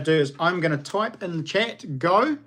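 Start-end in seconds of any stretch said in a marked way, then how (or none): none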